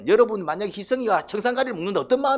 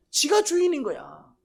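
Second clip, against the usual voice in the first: mock arrogant sneer; 8 semitones higher, 3 dB louder than usual. Preacher voicing Cain's arrogance.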